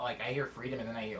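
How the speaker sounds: ordinary delivery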